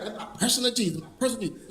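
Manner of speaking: soft possessive murmur; mock private murmur. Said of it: "They thought".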